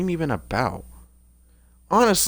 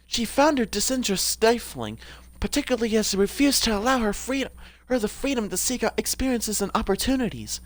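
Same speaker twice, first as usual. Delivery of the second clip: raised-pitch character voice; Anita; 4 semitones higher than usual. Acted. Streamer reading a character's bio aloud, higher put-on voice. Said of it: "what was Anita's voice?".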